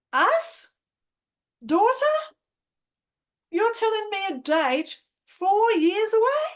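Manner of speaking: high, loud disbelieving questions; startled, pitch shooting up on each question; incredulous. Retold or imitated. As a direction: echo it back in disbelief. Acts out disbelief at prophecy.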